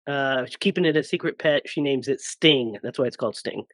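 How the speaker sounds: usual speaking voice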